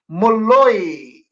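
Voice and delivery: ordinary delivery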